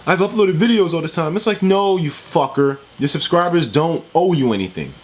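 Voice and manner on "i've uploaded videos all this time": higher, put-on voice mocking a greedy creator; mock whiny YouTuber. Vlogger mocks the YouTuber begging subscribers for money. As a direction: sneer as you imitate him. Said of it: "Talking about".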